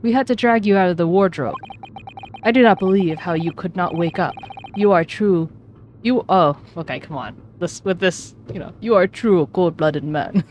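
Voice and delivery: attempted Russian accent. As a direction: interrogator with an unsure Russian accent. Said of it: "I don't know how to do a Russian accent".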